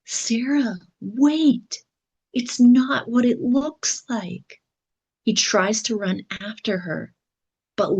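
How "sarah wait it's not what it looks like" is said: high, urgent narrated yell; yelled plea. A narrator's reading. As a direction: call after them, pitched well up. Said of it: "and yells".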